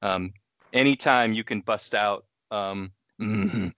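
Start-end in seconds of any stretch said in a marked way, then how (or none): 3.2-3.7 Sling Blade character. Pretend. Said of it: "Sling Blade, the Billy Bob Thornton movie".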